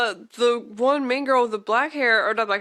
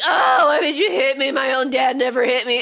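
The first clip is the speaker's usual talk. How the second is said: high, louder hammed-up tearful protest; mock crying; 5 semitones higher, 5 dB louder than usual. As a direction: wail it as a wounded cartoon girl. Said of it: "and she's crying and stuff".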